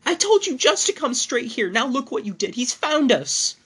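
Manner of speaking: high, loud panicked scream; wife screams at her lover. A narrator's reading. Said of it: "my wife began to scream".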